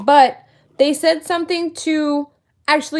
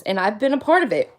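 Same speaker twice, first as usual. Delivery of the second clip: ordinary talk, nothing marked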